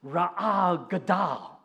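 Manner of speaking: Ra'ah gadol said very slowly, each Hebrew syllable drawn out; Hebrew phrase. Shown as a demonstration. Preacher reading Hebrew aloud to the congregation, slowly. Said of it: "it reads like this".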